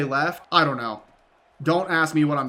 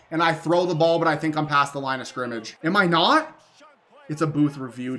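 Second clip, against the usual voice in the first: ordinary talk, nothing marked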